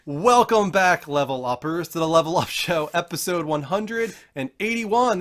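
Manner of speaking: slow, high, loud stream host welcome; big announcer welcome back; show host intro. Acted.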